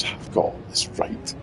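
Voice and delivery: whispered smug aside, pitched high. Retold or imitated. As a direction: whisper it to yourself, pleased with yourself. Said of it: "smugly turns a little headset off".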